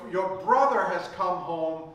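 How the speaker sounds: ordinary talk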